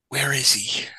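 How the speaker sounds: breathy imitated whisper from the angry boss; whispered boss question. The boss Frank hunts for a worker, imitated in a whisper. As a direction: hiss the question under your breath. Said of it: "Here comes Frank later".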